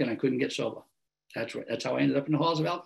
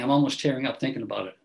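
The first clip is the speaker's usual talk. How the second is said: ordinary talk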